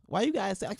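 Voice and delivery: normal talking voice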